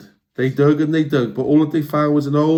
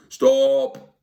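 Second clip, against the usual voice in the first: call out; 12 semitones higher than usual. Acted. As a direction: call a halt. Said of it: "called Hank Chief".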